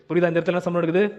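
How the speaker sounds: ordinary talk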